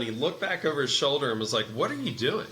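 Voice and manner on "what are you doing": mild abrupt demand. Retold or imitated. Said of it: "he can have an abrupt tone sometimes".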